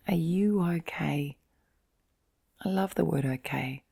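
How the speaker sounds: usual speaking voice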